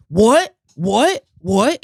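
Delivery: loud, rising one-word challenges; in-your-face challenge. Retold or imitated. Retelling a man confronting him in a restaurant, loud confrontational what. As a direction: throw each word at them, imitating an aggressor. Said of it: "In my face".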